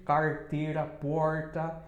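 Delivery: carteira and porta with a heavy curled R; strong caipira R. Shown as a demonstration. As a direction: lean into the country R. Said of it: "é bem forte mesmo".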